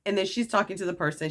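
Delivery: normal talking voice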